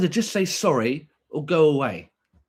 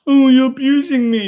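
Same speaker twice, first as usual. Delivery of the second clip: high, louder whining parody; whiny mock victim; 9 semitones higher, 8 dB louder than usual. Streamer mocking a chatter who cries abuse, high whiny parody. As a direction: whine it like a fake victim.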